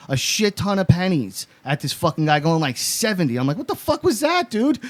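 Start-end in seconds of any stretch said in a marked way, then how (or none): 3.6-4.8 high-pitched, incredulous outburst; incredulous reaction. Retold or imitated. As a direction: retell it in a high, stunned voice. Reacting to friend throwing pennies.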